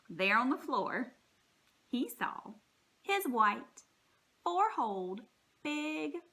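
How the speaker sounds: ordinary delivery, nothing marked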